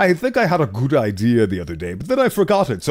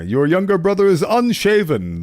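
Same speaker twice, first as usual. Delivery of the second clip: dramatic game narration read aloud; story narration; 7 semitones higher than usual. Acted.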